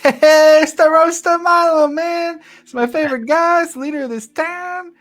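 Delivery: excited shopkeeper voice. Acted. Shopkeeper Michonne greeting town leader Milo, high and gushing. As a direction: gush over your favorite customer.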